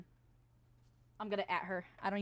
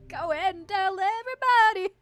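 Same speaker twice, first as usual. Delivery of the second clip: high, loud gleeful holler; triumphant shout; 11 semitones higher, 9 dB louder than usual. Real, not acted. Streamer repeats the catfish celebration, triumphant shout. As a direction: crow it out loudly.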